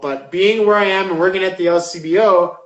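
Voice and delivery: normal talking voice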